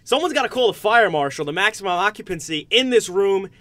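booming ring announcer intro; grand entrance announcement. Acted. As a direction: announce the show like a main event. Host opens show with announcer intro.

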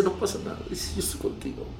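softly whispering the wife's consoling words; whispered comfort. Retold or imitated. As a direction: hush him like a grieving husband. Wife comforting him, quoted. (susurro de consuelo de la esposa)

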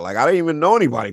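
usual speaking voice

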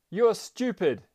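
high, loud angry taunt; frustrated shout. A narrator's reading. Boy annoyed at the voice, frustrated shout. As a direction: shout it angrily at an unseen voice. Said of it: "more angrily and frustrated, he shouted".

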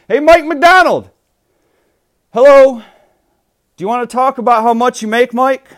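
loud, high eager interviewer; reporter voice. Acted. Mock press conference interviewer.

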